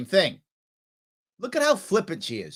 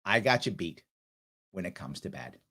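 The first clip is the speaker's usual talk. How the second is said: dropped almost to nothing, a rueful mutter; muttered repeat; 10 semitones lower, 7 dB quieter than usual. Real, not acted. Repeating the boast to viewers under his breath, rueful. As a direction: say it again under your breath.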